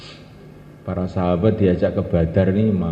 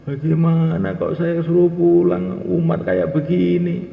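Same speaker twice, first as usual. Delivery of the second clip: tearful protest; 8 semitones higher, slower than usual. Retold or imitated. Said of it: "Pulang tuh nangis".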